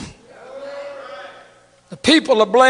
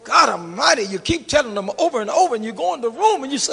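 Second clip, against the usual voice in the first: ordinary talk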